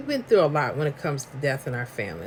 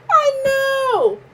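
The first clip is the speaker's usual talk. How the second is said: laughing; 4 dB louder than usual. Real, not acted. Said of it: "Your New York came out so clear".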